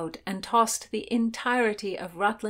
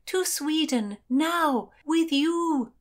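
high, emphatic call in separated phrases; bellowing; 10 semitones higher, slower than usual. A narrator's reading. Heroine repeats her request to ladies above, high bellow. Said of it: "Constance bellowed".

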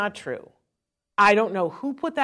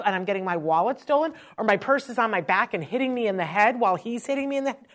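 ordinary delivery

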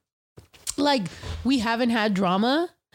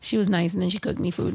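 usual speaking voice

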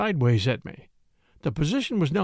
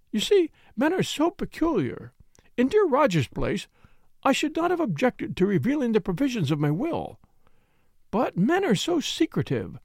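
higher, fluttering widow's voice; higher voice of an aggrieved widow; tearful Mrs Ackroyd; 7 semitones higher than usual. A narrator's reading. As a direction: plead like a flustered widow caught out.

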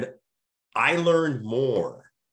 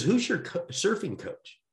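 mock buddy question; 11 semitones higher than usual. Buddy quoted asking about surfing.

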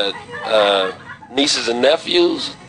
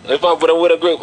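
usual speaking voice

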